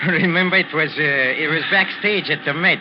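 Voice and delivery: loud, with bursts of laughter between phrases; laughing reminiscence. Acted. Maestro ignoring Ricky's discomfort to reminisce, laughing. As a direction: keep breaking into laughter as you recall. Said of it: "I must laugh when I think".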